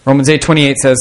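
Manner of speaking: usual speaking voice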